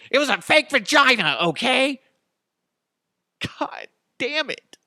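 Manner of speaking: high, loud frustrated shout; exasperated yell. Acted. Todd finally shouts the answer at the reporter, exasperated. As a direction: blurt it out at the top of your voice.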